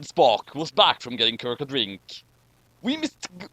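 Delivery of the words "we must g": a lightly higher Chekhov; a hint of a Russian officer. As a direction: play the eager officer Chekhov, pitched up. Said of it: "said Chekhov".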